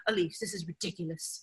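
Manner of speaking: a light imitation of a British fiance's accent, soft and dry. Imitating her British fiance in a mild British accent. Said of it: "he's British, so he'd be like".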